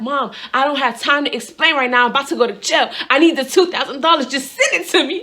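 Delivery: loud, high, frantic re-enacted plea; going crazy. Daughter begging mom for bail money, going crazy. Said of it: "I'm crying and I'm going crazy".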